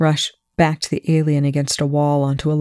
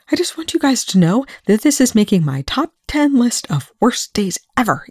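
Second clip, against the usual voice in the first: higher-pitched, exasperated complaint; indignato tra i denti; 7 semitones higher than usual. A narrator's reading. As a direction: complain under pressure, pitched up high. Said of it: "Eli hissed".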